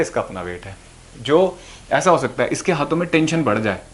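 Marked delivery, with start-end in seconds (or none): 0.0-0.8 much lower and softer, settling the point; quiet repeat. Shown as a demonstration. Teacher quietly echoing the rule to students, low and soft. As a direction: drop low and soft on इसका for the echo.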